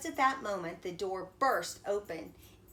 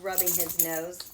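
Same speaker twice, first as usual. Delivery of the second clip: ordinary delivery, nothing marked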